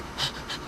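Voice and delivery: sharp unvoiced gasp for air. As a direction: gasp like she's out of breath. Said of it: "talk in a breathy voice".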